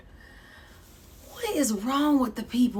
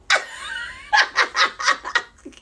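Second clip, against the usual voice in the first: high laugh. Real, not acted. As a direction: burst of high-pitched laughter. Bursts out laughing at own joke.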